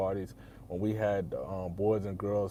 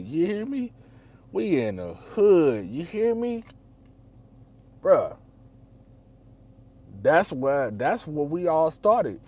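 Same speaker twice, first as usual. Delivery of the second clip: hyped up; 7 semitones higher, 9 dB louder, slower than usual. Real, not acted. Narrator hyping up the neighbourhood where they started, loud and proud.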